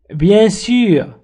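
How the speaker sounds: usual speaking voice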